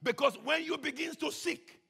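usual speaking voice

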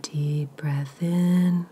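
ordinary delivery

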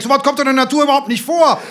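fast, high, loud tirade; shouting. A narrator's reading. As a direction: rattle off the insult at a yell. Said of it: "schrie Lebeck".

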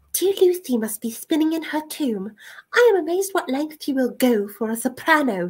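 high, sneering aristocratic lady; a haughty lady's sneer, pitched high. A narrator's reading. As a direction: sneer it down at him from a height. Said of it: "she sneered".

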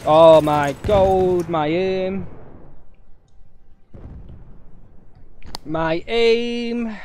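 exasperated, stretched-out gamer moan; exasperated lament. Real, not acted. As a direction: wail slowly over your own bad aim, pitch climbing. Player laments own bad aim.

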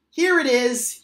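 high and loud triumphant shout. A narrator's reading. Little otter running into the kitchen with the found box, shouting. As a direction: show it off proudly. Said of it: "he shouted".

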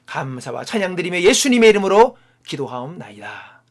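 fervent pastoral prayer in steady, measured phrases; closing prayer. Real, not acted. Pastor thanking God for his guidance, fervent prayer. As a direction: pray aloud earnestly, pressing on each petition, settling at amen.

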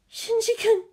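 very high, small distressed voice; crying out. A narrator's reading. Captive lieutenant thinking of the boy, crying out. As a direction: cry the name in a tiny high voice. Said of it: "the lieutenant cried".

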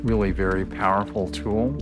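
usual speaking voice

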